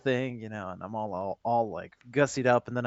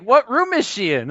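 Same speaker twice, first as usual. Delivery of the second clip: eager fan; 10 semitones higher, 6 dB louder than usual. Acted. Fans asking eagerly.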